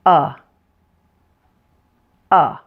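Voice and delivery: a relaxed, open uh; short U demo. Shown as a demonstration. Teacher models the short U for learners, relaxed uh. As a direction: say the short U uh with jaw loose. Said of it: "let's try it together".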